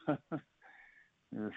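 laughing. Real, not acted. Laughing at distant memories.

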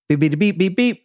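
quick high-pitched beeps; imitated beeping. Host mimicking the song's switch to a new theme, imitated beeping. Mimics a siren-like musical sting.

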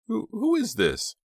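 slow, very high frightened stammer; scared, halting, high-pitched question; shrill stammer. A narrator's reading. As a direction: stammer it shrilly, trying to stay steady. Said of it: "she stammered out the question shrilly".

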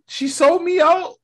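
betrayed by Jane, squeaking; indignant disbelief. Retold or imitated. Thor voiced in a retold scene.

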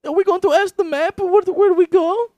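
very high, loud falsetto question; hammed-up squeaky cartoon voice; cartoon kid. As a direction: ask like a children's show host. Acting out Dora cartoon scene.

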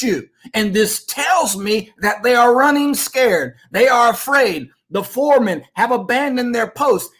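rousing, defiant preacher's cadence; fiery revolutionary speech. Acted. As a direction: declare it like a prophecy from the pulpit. Preaching against the globalists.

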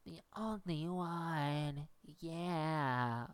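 put-on slow, nasal baby doll singing; Maddie impression. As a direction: drag it out like a whiny diva. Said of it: "This is my impression of Maddie".

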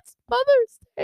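high, upset cry of complaint; exasperated wail. Retold or imitated. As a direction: plead it like a hurt mother.

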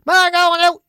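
high, loud holler to Ma; parrot mimicking kids. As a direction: shout it like a parrot copying the children. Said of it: "He memorised all the kids' voices".

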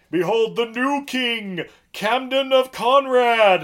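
high, loud, slow proclamation; grand herald. Retold or imitated. Merrick presenting the new king, in a loud slow proclamation. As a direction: announce it like a royal herald. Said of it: "and he's like".

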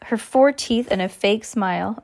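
usual speaking voice

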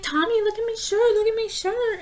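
put-on high excited child voice; squeaky kid showing off; excited little kid. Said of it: "he's like".

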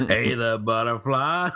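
slow Southern cowboy impression; drawn-out mock Southern cowboy greeting. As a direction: imitate a chat-up line in a Southern cowboy voice. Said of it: "the deep Southern cowboy voice and stuff".

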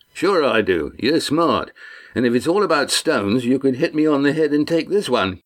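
lightly voiced rough sailor, cheering his friend on; a hint of a homespun deckhand, buoyant; Walt, encouraging sailor. A narrator's reading. Walt eggs him on.

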